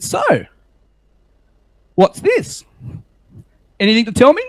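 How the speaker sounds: high, loud mock questioning; pointed retold double-take reaction; imitating surprised colleague. Colleague spots the speaker in the office, mock surprise.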